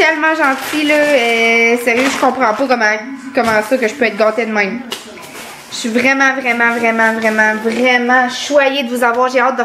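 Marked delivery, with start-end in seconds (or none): none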